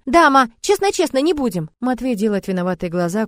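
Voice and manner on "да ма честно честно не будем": put-on higher child's eager promise. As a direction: echo the dad like a little boy. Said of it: "Костик повторяет".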